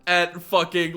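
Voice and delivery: ordinary talk, nothing marked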